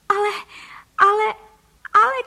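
high, loud spluttering cries; mock shriek. A narrator's reading. Wife aghast at her shrunken husband, shrieking. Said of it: "křičela paní Frejková v obličeji celá fialová".

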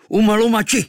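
high, loud war cry; shout. Acted. Kvíkvék shouts as he shoots, high and loud. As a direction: cry it out as you loose the arrow. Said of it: "vykřikl".

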